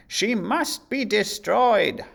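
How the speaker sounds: high, loud, slow vengeful decree; evil queen's decree; angry queen. A narrator's reading. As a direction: pronounce the sentence coldly furious. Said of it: "the angry queen".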